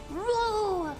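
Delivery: usual speaking voice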